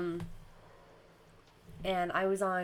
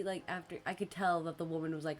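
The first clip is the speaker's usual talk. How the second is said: normal talking voice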